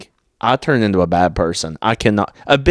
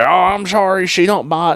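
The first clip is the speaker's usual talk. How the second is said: retold owner; 9 semitones higher than usual. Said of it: "And he's just like".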